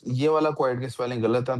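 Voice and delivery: ordinary talk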